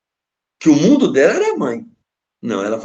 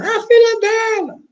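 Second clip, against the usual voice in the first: mock high girl's voice, much higher; imitating a girl; 4 dB louder than usual. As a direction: squeak it like the little daughter. Filha atende o telefone. (voz de menina bem aguda, imitada)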